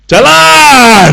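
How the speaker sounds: high, loud drawn-out call; imitated minibus send-off shout; departure call. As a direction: call out that it's time to go. Mimics angkot departing when full.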